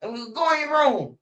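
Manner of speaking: high, mock-snotty voice; bratty friend. Friend telling his mama off, high and snotty. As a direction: mimic the friend snapping at his mother.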